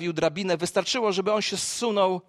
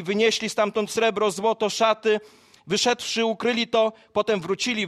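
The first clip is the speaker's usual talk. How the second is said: higher, louder preaching, driving through the list; preaching at full force; 4 semitones higher, 4 dB louder than usual. Acted. Preacher retelling the lepers' story to the congregation, full-force preaching. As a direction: build it like a fired-up sermon.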